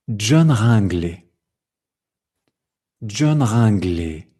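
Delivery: slow "John Ringley" descending to a long final "gley"; progressive fall. Shown as a demonstration. Teacher demonstrating French intonation, slowly falling. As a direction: step down through "John Ringley", stretching the end. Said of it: "Je descends".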